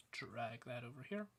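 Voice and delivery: a quick whispered apology while fixing things; whispered aside. Real, not acted. Presenter fixing his screen share mid-talk, whispered aside. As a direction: murmur it under your breath.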